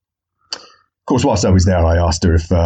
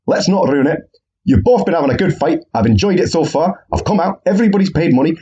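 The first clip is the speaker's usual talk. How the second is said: mock referee; 8 semitones higher than usual. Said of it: "So the referee says".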